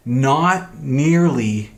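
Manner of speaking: "not nearly" very slow, "nearly" leaned on; slow, strong "not nearly"; stress on nearly. Shown as a demonstration. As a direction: drag out "not nearly" for emphasis. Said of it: "gives emphasis".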